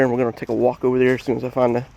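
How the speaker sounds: ordinary talk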